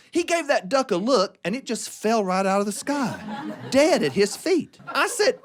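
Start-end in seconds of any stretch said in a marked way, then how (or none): none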